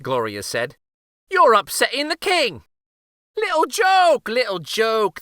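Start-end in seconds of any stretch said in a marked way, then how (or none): 1.3-2.6 high, loud mild scolding. A narrator's reading. Gloria scolds uncle. 3.4-5.2 high, playful brush-off; chuckling uncle. A narrator's reading. Uncle voiced in story.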